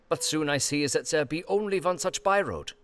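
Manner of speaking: ordinary talk, nothing marked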